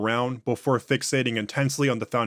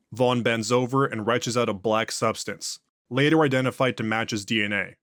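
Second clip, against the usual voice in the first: ordinary delivery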